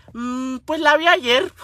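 lento, con un mm pensativo; vecino pausado imitado. Retold or imitated. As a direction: contesta sin prisa, arrastrando las palabras. (respuesta pachorruda del vecino)

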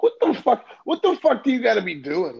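high, loud astonished outburst; amazed disbelief. Real, not acted. Cohost reacts to friend's celebrity story, amazed disbelief. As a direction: burst out in real disbelief.

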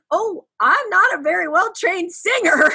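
ordinary talk

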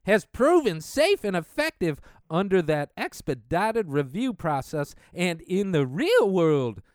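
brisk newsreader reading of the report; news read. Acted. Reading the article to his viewers like a newscaster.

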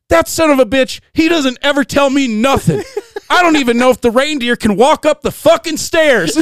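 high, loud, furious ranting impression; imitated meltdown. Handler's wife cursing her husband before shoot, imitated meltdown. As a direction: rant like a wife at wit's end. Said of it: "a total meltdown right beforehand".

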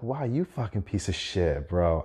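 usual speaking voice

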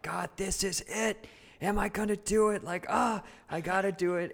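high, tense, nervous self-talk; terrified. Retold or imitated. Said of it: "you're so terrified".

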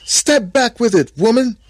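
high, loud warning shout; angry command. A narrator's reading. Adam refusing Eve's fruit, angry shouted warning. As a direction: order her back at full voice.

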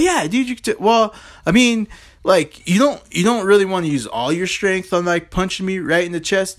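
high, backpedaling warning; nervous guard. Retold or imitated. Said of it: "He's like".

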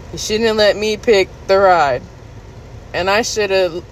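ordinary delivery

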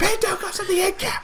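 mock yell, very high and loud; mock manager rant. Mocking the raging manager for friends, hammed-up yell. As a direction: parrot the manager's tantrum for laughs. Mimicking the ranting manager again.

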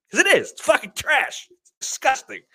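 high mock outrage. Retold own quote in the argument.

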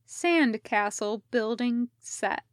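usual speaking voice

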